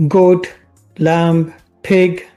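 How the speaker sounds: normal talking voice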